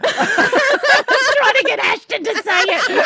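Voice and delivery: laughing. Real, not acted. Laughing at the joke.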